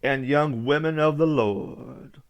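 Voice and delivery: put-on slow, drawn-out old preacher address. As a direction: stretch it out like preaching to the flock. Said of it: "he talked like this".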